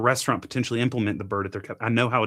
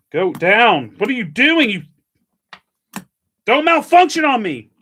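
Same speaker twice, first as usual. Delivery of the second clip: higher, louder shouting at the machine; frustrated yell; 8 semitones higher, 11 dB louder than usual. Real, not acted. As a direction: bark orders at a stuck gadget. Yelling at a stuck coffee device.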